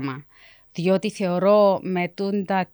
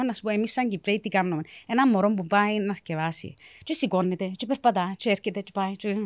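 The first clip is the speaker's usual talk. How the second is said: normal talking voice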